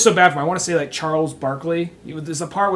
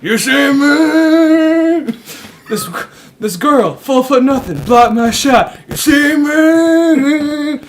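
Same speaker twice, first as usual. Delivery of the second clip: slow, louder, higher dramatic ranting; milk each line like a washed-up star; over-the-top drama parody monologue; hammed-up tormented athlete; 7 semitones higher, 8 dB louder, much slower than usual.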